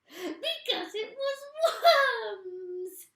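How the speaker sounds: very slow, loud, high cackling cry; cackling triumph. Acted. Missus Twit gloating over her husband. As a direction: crow it out gleefully. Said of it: "cried Missus Twit".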